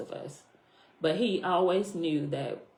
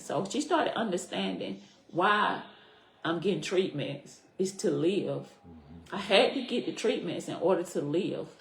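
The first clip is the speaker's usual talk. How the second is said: ordinary talk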